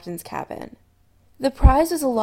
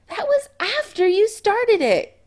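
glaring; 12 semitones higher, 5 dB louder than usual. A narrator's reading.

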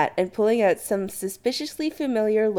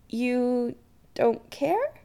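hesitant; 6 semitones higher, much slower than usual. Acted. Chris to Adam after confessing, hesitant. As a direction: pause mid-question, unsure. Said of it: "Chris says hesitantly".

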